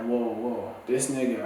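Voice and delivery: normal talking voice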